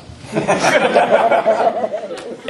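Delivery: a high, breathy laugh breaking out; amused laugh. Real, not acted. Dad laughing at his son's text, amused laugh. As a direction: laugh at the memory.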